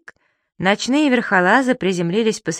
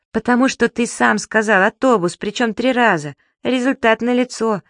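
higher, louder, through held-back giggles; giggling; 6 semitones higher, 4 dB louder than usual. A narrator's reading. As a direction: tease him while trying not to laugh. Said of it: "хихикнул Зубастик".

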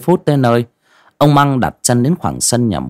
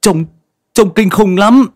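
much higher and louder, stammering in panic; terrified stammer; 12 semitones higher, 6 dB louder than usual. Acted. Young man stammers to the old man about the dead woman, panicked. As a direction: stutter it out, terrified of what he saw. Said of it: "giọng lắp bắp".

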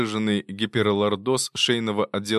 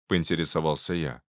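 usual speaking voice

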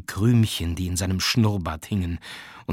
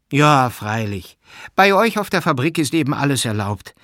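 scornful jibe; 4 semitones higher, 6 dB louder than usual. A narrator's reading. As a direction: sneer it loudly at the sinner. Aglaja sneers at the sinner. (höhnisch und laut)

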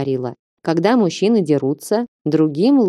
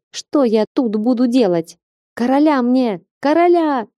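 high-pitched narrated hysteria, climbing line by line; hysterical outburst; 7 semitones higher than usual. A narrator's reading. Stranded older woman panicking about her fate, hysterical. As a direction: voice rising higher and higher into hysterics. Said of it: "Женщина истерично засмеялась".

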